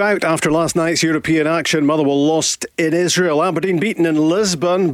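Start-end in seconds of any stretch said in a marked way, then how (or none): none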